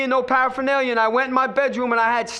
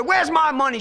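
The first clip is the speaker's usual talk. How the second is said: demanding his money; 6 semitones higher than usual. Retold or imitated. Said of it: "I said".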